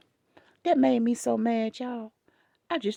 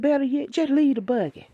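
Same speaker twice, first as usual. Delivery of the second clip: louder, commanding telling-off; retold heated orders to the grandfather, louder; 5 dB louder than usual. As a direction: boss him out of the store. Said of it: "I said".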